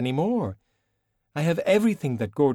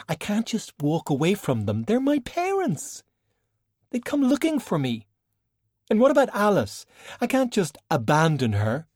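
much higher, pleading and upset; distressed; 11 semitones higher than usual. Acted. Valkyrie refuses to leave her parents, distressed and high. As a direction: voice lifting in alarm at the thought.

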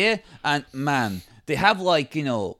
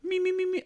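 tiny high mock warm-up; soft, high-pitched voice exercise; vocal warm-up; 9 dB quieter, faster than usual. Shown as a demonstration. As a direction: squeak softly up high to find the voice. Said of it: "Let me try and do it".

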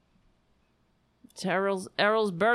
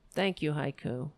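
ordinary talk

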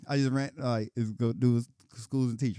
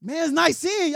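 much higher, louder fake pleasantry; fake politeness; 14 semitones higher, 10 dB louder than usual. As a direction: chirp it up like a forced greeting.

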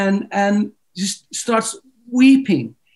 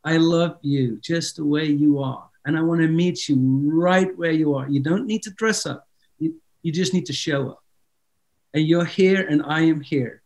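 soft, lower-pitched spoken prayer; gentle prayer; 4 semitones lower, 4 dB quieter than usual. Real, not acted. Praying over everyone watching, soft and reassuring prayer. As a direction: speak tenderly and unhurriedly, as God to the listener. Prays aloud for listeners.

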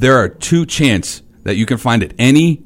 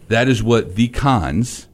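ordinary talk

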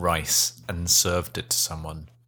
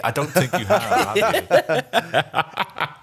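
laughing; 13 semitones higher, much slower than usual. Real, not acted. Teasing co-host, laughing.

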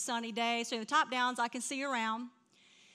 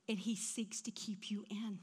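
hushed and unvoiced, much softer; tender whisper; 4 semitones lower, 11 dB quieter than usual. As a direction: let it fall to a whisper. Tender whispered close of point.

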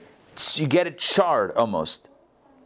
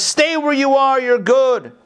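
higher and louder, soothing insistence; voice of water; 10 semitones higher, 6 dB louder, slower than usual. Acted. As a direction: call it out high, coaxing someone to stay put. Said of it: "The water says".

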